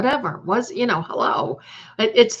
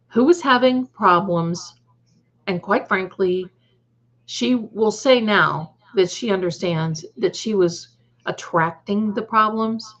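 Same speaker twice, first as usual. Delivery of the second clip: ordinary talk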